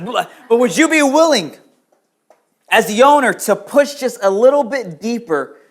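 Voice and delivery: loud, high, pressing preacher's call; full-force preaching. Acted. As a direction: drive each question home to the crowd. Pastor preaching to the congregation.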